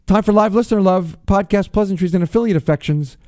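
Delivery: radio announcer. Acted. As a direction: announce the segment like a show opener.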